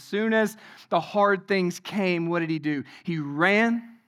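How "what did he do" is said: ordinary delivery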